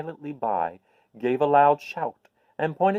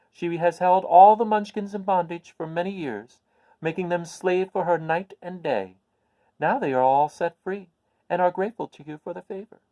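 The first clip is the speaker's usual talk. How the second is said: put-on higher, kindly old witch telling news; a little old witch, pleased to share good news; 7 semitones higher, 6 dB louder than usual. Witch of the North explains.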